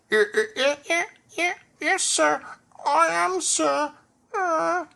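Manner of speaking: high stammering from behind clothes; muffled voice. A narrator's reading. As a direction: stammer as if buried under clothes. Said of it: "he said in a muffled voice".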